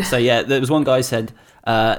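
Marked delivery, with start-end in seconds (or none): none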